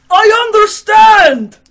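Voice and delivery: very high, drawn-out full scream; top-of-lungs shout. Acted. Mitya suddenly bursts out at the gentlemen, screaming at full volume. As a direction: shout it as if beside yourself. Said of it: "exclaimed loudly at the top of his lungs".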